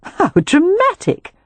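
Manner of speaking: high, slow, dismissive scoff. Acted. Poppy teasing her sister, high, slow and dismissive. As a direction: brush it off with mock drama. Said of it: "Poppy said with a dismissive laugh".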